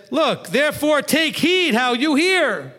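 high, slow, ringing call; loud proclamation. Retold or imitated. Rabbi voicing Yeshua calling loudly to a big crowd. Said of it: "I think he got loud here".

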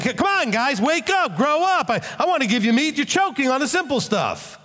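high, exasperated rebuke; a preacher imagining Paul scolding the believers; Paul in their face. Retold or imitated. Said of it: "That's what he sounded like in my head".

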